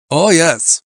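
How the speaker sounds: put-on loud, high German-accented reply; German climber, full of himself; emphatic German climber. A narrator's reading. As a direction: confirm it forcefully, German accent. Freitag's German character voice.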